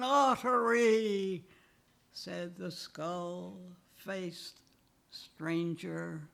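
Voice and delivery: measured, deliberate poetry reading with voiced speakers; poem reading. Poet reading his exile poem to an audience, measured and deliberate. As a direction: read the verses like a poet at a podium.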